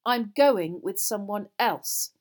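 slow, loud, angry declaration; emphatic, fed-up fury; very angrily. A narrator's reading. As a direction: hammer out each word in anger. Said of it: "very angrily".

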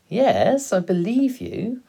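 put-on high, loud boyish reply; amused young son. A narrator's reading. Boy assuring his father he believes him, amused.

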